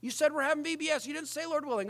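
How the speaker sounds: high, put-on voice of a fussy church scold; mocking nitpicker. Preacher mocking a legalistic churchgoer, high sneering nag. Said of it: "you always have to say".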